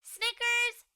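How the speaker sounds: loud high call across the yard; shouted name. A narrator's reading. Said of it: "Bluey shouts to Snickers".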